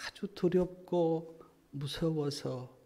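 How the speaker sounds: slow and soft, weighted on 두렵고. Acted. Preacher slowly describing the lost sheep's fear. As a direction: lay out 두렵고 무서워서 slowly, heavy with fear. First take of the phrase.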